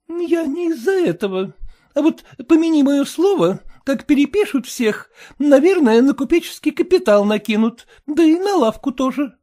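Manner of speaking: high, sour, fretful wife voice; high complaining wife; sour merchant's wife. A narrator's reading. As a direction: whine it like a sour housewife.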